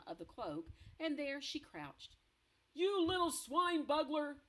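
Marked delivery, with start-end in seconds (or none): 2.7-4.4 loud, furious giant insult; furious roar. Acted. Blood Bottler raging at the BFG, loud furious roar. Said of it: "roared the Blood Bottler".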